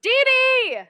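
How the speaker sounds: high, loud pleading cry of a name; pleading call. Acted. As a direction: beg your friend to spill it. Friend begging to hear gossip.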